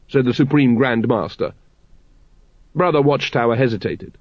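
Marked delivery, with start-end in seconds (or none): none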